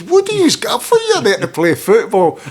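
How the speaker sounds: high, loud indignant question; mock outraged yell; retold outburst. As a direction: fire the question out, pitched right up. Acted outburst in a retold exchange.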